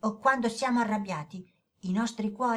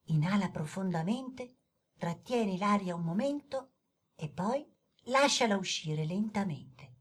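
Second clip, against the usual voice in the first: soft, lower, calm guiding voice; guided breathing; 4 semitones lower, 3 dB quieter than usual. Acted. Daniele teaching the boy the breathing exercise, soft guided calm. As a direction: lead him gently through the breath.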